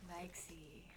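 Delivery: shy whisper; breathy shy whisper. Real, not acted. Shy answer.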